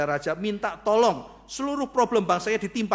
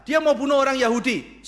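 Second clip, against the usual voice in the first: louder, higher, pressing the accusation; urgent protest; 7 semitones higher, 8 dB louder than usual. Acted. Esther protesting loudly to the king. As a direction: push it out, pleading with a careless ruler.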